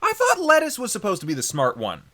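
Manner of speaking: loud, high-pitched objection; incredulous protest. Real, not acted. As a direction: object loudly, pitch jumping up. Protesting a friend's claim.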